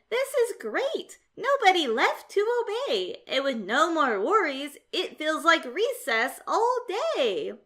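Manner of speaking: thrilled there's no school rules left; a cat celebrating an endless recess; David, delighted. Acted.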